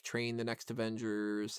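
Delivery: usual speaking voice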